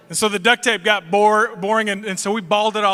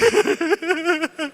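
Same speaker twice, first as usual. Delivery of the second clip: mock whimper; 7 semitones higher than usual. Kid about to break down.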